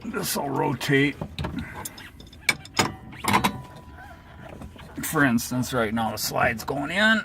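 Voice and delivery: straining while talking; grunting with effort. Real, not acted. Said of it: "Sorry for all the grunting".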